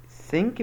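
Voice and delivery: "سينك" begun with an s, like sink; pronounced with S. Shown as a demonstration. Teacher questioning a learner's mistake, "سينك" with an s. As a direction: say "سينك" with s, tongue behind the teeth. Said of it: "بالاس".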